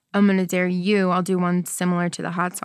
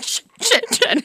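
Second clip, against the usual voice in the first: high, panicked cursing; comic flustered swearing; mock panic; 13 semitones higher than usual. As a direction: replay an oh-no moment in a raised pitch. Retells panicked deleting of accidental post.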